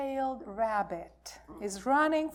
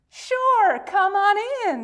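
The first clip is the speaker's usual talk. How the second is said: much higher, louder happy invite; cheerful welcome; 9 semitones higher, 7 dB louder than usual. Acted. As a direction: squeal the welcome gladly. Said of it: "they say".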